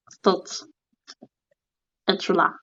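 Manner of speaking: low, soft mock séance voice; summoning call. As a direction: call the spirit low and softly. Mock séance summons, turtle objects. (voix basse et feutrée d'invocation)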